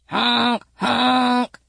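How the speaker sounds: honk, honk like car horns. A narrator's reading. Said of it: "went the horns".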